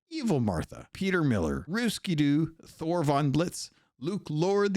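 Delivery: usual speaking voice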